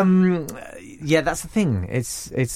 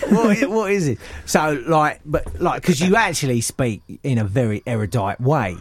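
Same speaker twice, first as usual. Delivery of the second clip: mock London voice, fumbling for words; exaggerated London accent. Host flatters guest's speech in mock London accent. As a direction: overdo the London vowels as you stumble. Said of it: "and not in that accent".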